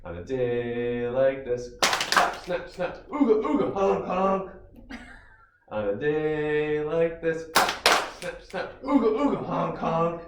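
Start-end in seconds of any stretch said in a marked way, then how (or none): 2.7-4.5 goofy ooga and honk noises; silly clown. Shown as a demonstration. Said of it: "fun song motions and sounds". 8.8-10.2 goofy ooga and honk noises; silly clown. Shown as a demonstration. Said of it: "fun song motions and sounds".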